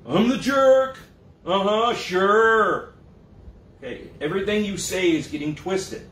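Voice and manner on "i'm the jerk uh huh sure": loud, high mocking whine, drawn out at the end; hammed-up sarcastic victim; sarcastic self-pity. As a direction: sneer it back, playing the martyr. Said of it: "Why are you acting that way?".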